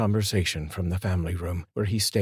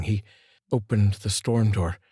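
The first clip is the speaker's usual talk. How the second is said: usual speaking voice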